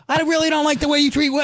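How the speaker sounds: fast, high-pitched mock scolding; retold indignant telling-off; ballistic father. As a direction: imitate a fed-up dad, rushing the words way up high. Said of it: "He went ballistic".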